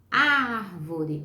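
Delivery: first syllable of "árvore" stretched and punched; sílaba tônica forte. Shown as a demonstration. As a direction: stress the opening ÁR of "árvore", drawn out. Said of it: "Preste atenção no pedacinho mais forte". ("árvore" com o "ár" forte e alongado)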